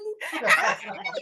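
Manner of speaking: mocking kid laugh. Boy mocks fallen narrator.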